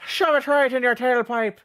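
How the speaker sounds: high, loud mock Irish accent. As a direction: hurl the threat in hammed-up Irish.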